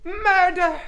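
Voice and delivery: loud hammed-up outcry of murder; dramatic cry. Owner announcing the murder to everyone, loud melodramatic cry. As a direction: bellow it like a stage tragedy.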